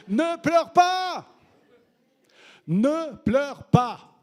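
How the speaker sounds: Jesus's drawn-out ringing reassurance; tender loud command. Acted. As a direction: proclaim it slowly, twice. Jesus answering the believer.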